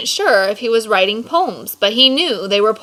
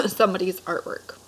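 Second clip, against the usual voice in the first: ordinary delivery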